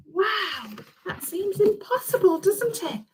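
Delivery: soft, wondering aside; hushed wonder. A narrator's reading. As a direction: marvel quietly to the children.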